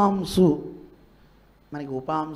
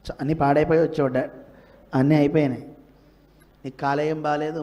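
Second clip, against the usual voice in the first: ordinary talk, nothing marked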